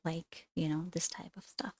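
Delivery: a quick whispered aside to the class. Real, not acted. Teacher whispering an aside to her students. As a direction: drop to a breathy whisper for the aside.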